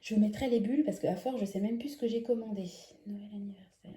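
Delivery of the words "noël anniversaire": muttering quietly. Real, not acted. Reading out labels under breath.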